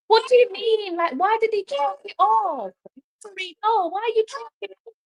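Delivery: high, fast, disbelieving protest; incredulous outrage. Real, not acted. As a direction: demand an answer, voice jumping up. Outraged at the goal being chalked off.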